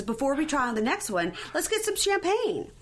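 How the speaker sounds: high, bubbly saleslady imitation; shop lady. Retelling the shop lady's staged champagne offer, high and bubbly. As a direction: chirp it like an eager saleswoman. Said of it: "And she says".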